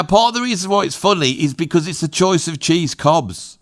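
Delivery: ordinary talk, nothing marked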